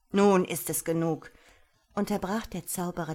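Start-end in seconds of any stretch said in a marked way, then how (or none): 0.1-1.3 louder, brooking no argument; commanding. A narrator's reading. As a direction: cut them off with authority. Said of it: "seine Stimme duldete keinen Widerspruch".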